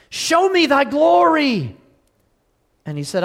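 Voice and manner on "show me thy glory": pleading cry. Acted. Moses pleads to see God.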